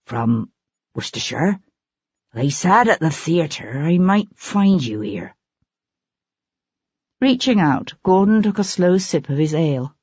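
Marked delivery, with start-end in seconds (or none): 0.0-5.4 lightly voiced timid young man, sentences lifting like questions; a hint of a nervous stranger, each phrase ending up; unsure, questioning inflection. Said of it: "brought the inflection of his voice up at the end".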